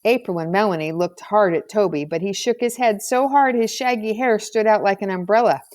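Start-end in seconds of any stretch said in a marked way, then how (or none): none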